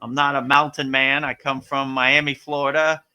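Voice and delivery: ordinary talk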